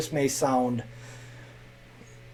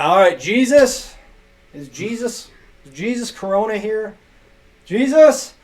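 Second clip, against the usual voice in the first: loud, drawn-out roll call, rising on the last Jesus; calling out the name; 8 semitones higher, 9 dB louder than usual. Retold or imitated. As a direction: shout the name across a classroom.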